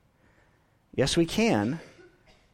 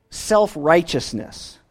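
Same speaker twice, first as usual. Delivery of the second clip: usual speaking voice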